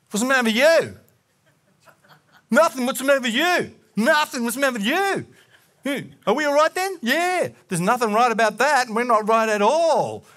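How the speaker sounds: loud, high-pitched mock quarrel; confrontational. Acts out his old confrontational question.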